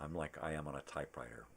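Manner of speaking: usual speaking voice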